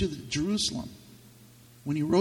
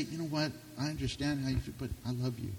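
tender, soft; 2 semitones lower, 9 dB quieter, faster than usual. Retold or imitated. Answering hatred with love.

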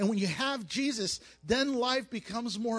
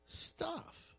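whispered punchline; 7 semitones higher, 9 dB quieter than usual. Acted. Dismissive punchline word.

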